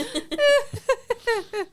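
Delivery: high villain cackle; evil cackle. Pretend. Villain laugh after unmasking.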